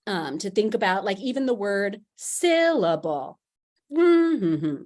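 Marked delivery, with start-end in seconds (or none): 2.1-3.4 syllable with SYL drawn out, the rest falling away; strong first syllable. Shown as a demonstration. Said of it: "strong, weak, weak". 3.9-4.8 hummed stress pattern. Shown as a demonstration. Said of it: "maybe hum it or sing it".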